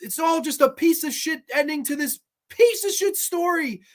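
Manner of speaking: high-pitched, agitated mock wail; imitated breakdown. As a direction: act out a man breaking down. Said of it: "as he breaks down".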